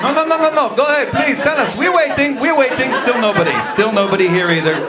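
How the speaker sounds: goading someone to speak up; confrontational Israeli. Retold or imitated. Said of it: "Israeli voice continued".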